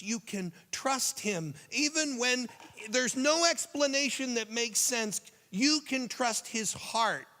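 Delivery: high, loud, slow preaching build; impassioned preaching. Acted. Preacher to congregation, slow and impassioned. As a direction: preach it out, stretching each phrase.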